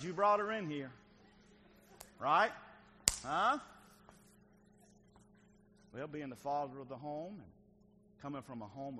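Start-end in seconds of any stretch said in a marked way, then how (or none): 0.0-3.6 loud, higher wife's comeback; mock accusing retort. Wife to husband over the crying baby, loud retort. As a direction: throw it back with sharp rising questions.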